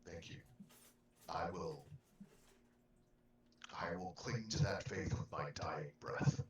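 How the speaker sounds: put-on grave, measured old believer; solemn in-character voice. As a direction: speak as a devout elder. In-character reply in the game.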